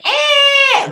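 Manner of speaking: very high piercing alien shriek, loud; Body Snatchers scream. Retold or imitated. Recapping the show, the alien spots her: Body Snatchers scream. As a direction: shriek like a pod person spotting a human. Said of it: "Very much, uh, Body Snatchers, uh, scream".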